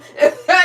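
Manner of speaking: sudden high loud laugh that breaks out; burst of laughter. Real, not acted. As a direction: let a real laugh burst out of you. Laughing at enemies' voodoo.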